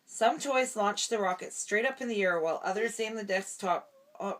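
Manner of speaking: ordinary delivery, nothing marked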